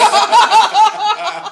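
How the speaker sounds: high, loud burst of laughter. Real, not acted. Laughs at squashed frog.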